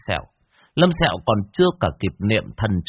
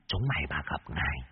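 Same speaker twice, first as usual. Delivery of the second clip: possessing demon; 4 semitones lower, 10 dB quieter than usual. Acted. Yêu vương possession voice, threatening.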